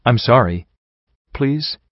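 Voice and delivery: mildly groveling stallion, pleading; understated begging apology. A narrator's reading. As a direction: plead for mercy with a touch of grovel. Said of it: "the stallion groveled".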